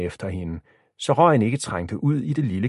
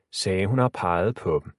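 ordinary delivery, nothing marked

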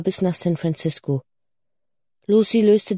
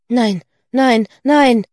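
loud, higher cry of refusal; shouted protest; 4 semitones higher, 6 dB louder, slower than usual. A narrator's reading. Lucy protesting to her mother, shouted. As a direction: cry out no, building to the last. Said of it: "schrie Lucy".

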